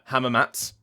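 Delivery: Hamamats ending on a bare ts; dropped final vowel. Shown as a demonstration. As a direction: clip off the oo in "Hamamats". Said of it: "you have people pronouncing it".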